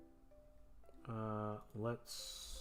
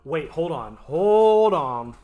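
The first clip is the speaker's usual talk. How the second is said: loud, high, drawn-out "hold on"; sudden realisation; 4 semitones higher, 12 dB louder, much slower than usual. Real, not acted. Editor catching a clue while troubleshooting, loud and drawn out. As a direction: stop everything, stretching it out loudly.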